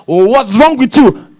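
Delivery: loud, slow reproach; harsh scolding. Acted. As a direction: scold them like a disappointed parent. Leader scolding, shown wrong way.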